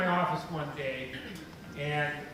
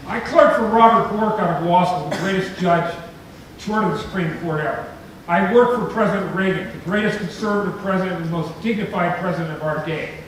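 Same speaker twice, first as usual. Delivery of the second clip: loud, driving public address; rousing speech; 3 semitones higher, 9 dB louder than usual. Real, not acted. Speaker rallying an audience with a loud, rousing speech. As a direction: stir up the crowd.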